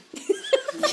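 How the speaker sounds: bursting high laugh at the witch joke; a sudden, loud, high laugh; big laugh. Real, not acted. Host laughing at the psychic meetings joke, high and loud.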